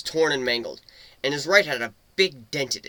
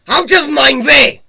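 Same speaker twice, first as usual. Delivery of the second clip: furious shout; 12 semitones higher, 15 dB louder than usual. Acted. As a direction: roar it out high and loud, slowly. Furious escape, battling wolves.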